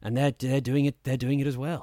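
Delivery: lightly voiced calm posh actor; a hint of a smooth British narrator; smooth soft-spoken voice. Shown as a demonstration. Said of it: "They talk like this".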